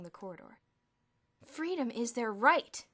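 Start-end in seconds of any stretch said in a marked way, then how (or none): 1.4-2.9 slow, loud and solemn. A narrator's reading. Bruno freeing the ants, speaking solemnly. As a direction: declare it like a grand principle. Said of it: "Bruno continued solemnly".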